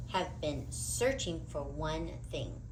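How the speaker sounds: usual speaking voice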